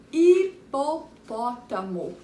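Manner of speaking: "hipopótamo" slowly drawn out, loud first beat; big, slow "hipopótamo" for the class; stretched-out syllables. Shown as a demonstration. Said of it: "Essa é grande".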